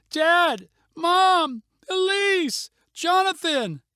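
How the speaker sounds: high, loud calling of each name; desperate yell. A narrator's reading. Girl yelling for her lost family again. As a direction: shout each name into the dust. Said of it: "She yelled again".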